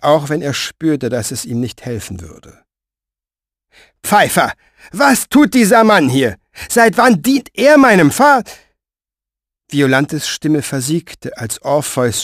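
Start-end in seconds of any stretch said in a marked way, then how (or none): 4.0-8.4 higher and louder, rising to an outburst; a princess demanding answers, cut short; Violante's indignant demand. Acted. (höher und lauter, empört, abgebrochen)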